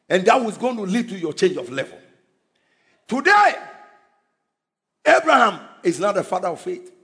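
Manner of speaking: usual speaking voice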